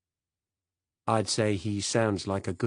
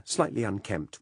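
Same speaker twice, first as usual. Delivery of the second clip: ordinary delivery, nothing marked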